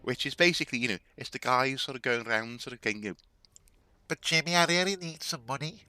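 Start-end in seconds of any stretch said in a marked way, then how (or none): 4.1-5.8 high, loud Liverpool scouse plea; heavy Liverpool accent pleading for cash; thick Scouse accent. Retold or imitated. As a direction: beg for money in broad Scouse. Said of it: "your really scouse scouse".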